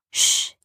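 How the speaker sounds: firm "shh"; hushing. A narrator's reading. Rowan grabs the parrot's beak, firm hushing. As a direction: hush the bird with one firm breath.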